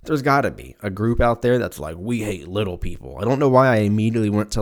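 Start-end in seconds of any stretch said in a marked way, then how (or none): 2.0-3.2 lightly voiced Southern churchgoing man; southern US accent.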